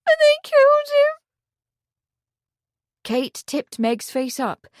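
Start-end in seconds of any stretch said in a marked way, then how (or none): 0.0-1.2 very high, loud anguished cry; voice rising in grief. A narrator's reading. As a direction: let the grief burst out. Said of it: "Her voice rose".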